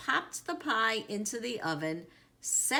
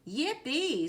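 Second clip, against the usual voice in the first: cheer; 7 semitones higher than usual. A narrator's reading. Child cheers about pie party.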